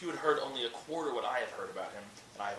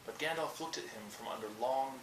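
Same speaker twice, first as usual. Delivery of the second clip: plain reading voice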